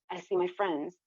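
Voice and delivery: plain reading voice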